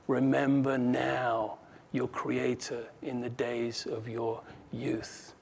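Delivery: slow, low, soft verse; hushed reading. Acted. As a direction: read the card gently, fading away. Card's verse read at story climax.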